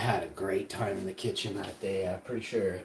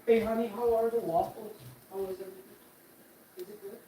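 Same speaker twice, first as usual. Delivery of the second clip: tender; 11 semitones higher, faster than usual. Real, not acted. Asking partner about waffles.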